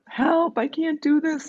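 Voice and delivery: high, pleading voice, near tears; imitating her own panicked crying call for help; retold hysterical plea. Calling her twin sister in a high, panicked, tearful plea. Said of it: "hysterical crying".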